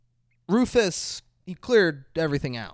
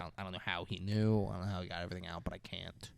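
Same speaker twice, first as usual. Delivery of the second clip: softer, fast, nearly whispered; hushed aside; 7 semitones lower, 11 dB quieter, faster than usual. Retold or imitated. Agent quietly explaining himself to his partner, hushed. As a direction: mutter it so the agent can't hear.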